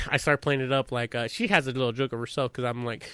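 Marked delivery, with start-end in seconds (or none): none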